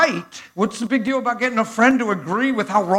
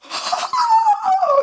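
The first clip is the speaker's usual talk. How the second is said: high, drawn-out mock crying gasp; imitated tearful gasp. Preacher imitating woman who started to cry, tearful gasp. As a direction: gasp as if bursting into tears. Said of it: "and started to cry".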